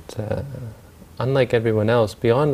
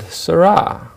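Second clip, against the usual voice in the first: higher, louder haughty call; lordly address; 7 semitones higher, 6 dB louder than usual. Retold or imitated. Rich man rebukes the city man, lordly address. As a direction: address an underling in a raised, drawn-up voice.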